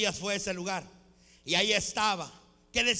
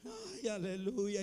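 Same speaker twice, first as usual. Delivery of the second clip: very soft, slow, affectionate whimper; mock tearful endearment; 4 semitones lower, 15 dB quieter, slower than usual. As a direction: whimper it fondly, hammed-up. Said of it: "le dicen cariñosamente al marido". (lloriqueo cariñoso fingido)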